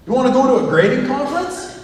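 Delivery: hammed-up colleague selling the trip; mock eager colleague. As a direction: play the pushy colleague, bright and keen. Colleague voiced inviting to conference.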